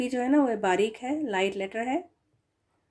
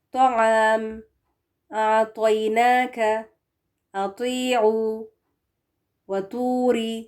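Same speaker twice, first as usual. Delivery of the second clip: slow, drawn Quranic recitation; 5 dB louder, much slower than usual. Acted. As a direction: recite each word slowly and clearly.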